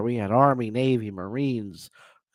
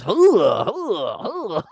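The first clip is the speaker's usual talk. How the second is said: high, loud barked army chant fading; barked hooah; 7 semitones higher, 3 dB louder, much slower than usual. Retold or imitated. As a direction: bark it like an Army grunt. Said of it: "the Army is".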